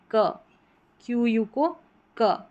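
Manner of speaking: ordinary talk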